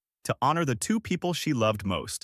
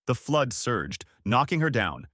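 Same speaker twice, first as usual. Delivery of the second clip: plain reading voice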